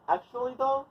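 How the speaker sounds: high chant for others to repeat; demonstrated call-out. Shown as a demonstration. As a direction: pitch it high and hang on the last word. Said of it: "say it eight times".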